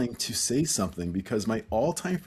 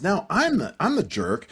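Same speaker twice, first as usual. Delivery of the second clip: usual speaking voice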